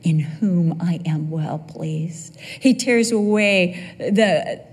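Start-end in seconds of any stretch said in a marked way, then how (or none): 0.0-2.4 low, slowing, weighty declaration; lightly acted voice of God, low and deliberate; God, proud father. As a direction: announce a beloved son with deep pride. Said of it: "God gets giddy over Jesus. He says".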